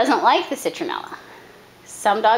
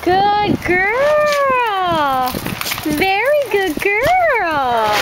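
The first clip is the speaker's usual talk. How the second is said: gushing praise; 7 semitones higher, much slower than usual. Shown as a demonstration. Trainer praising dog lavishly.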